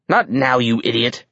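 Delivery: ordinary delivery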